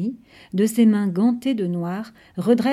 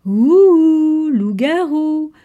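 slow, high, loud spooky howl; mock wolf howl; 7 semitones higher, 9 dB louder, much slower than usual. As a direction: howl it as a joke. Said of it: "hurle Damien pour rire".

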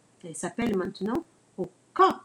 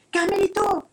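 higher, loud call to her brother; excited loud call; cry out; 10 semitones higher, 5 dB louder than usual. Acted. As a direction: call his name urgently. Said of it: "s'écria la poulette".

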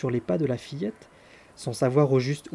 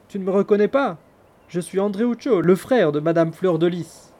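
higher and louder, insisting; indignant appeal; 4 semitones higher, 7 dB louder than usual. A narrator's reading. Andreuccio calling up to the maid, insistent. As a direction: plead to be recognised. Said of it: "dit Andreuccio".

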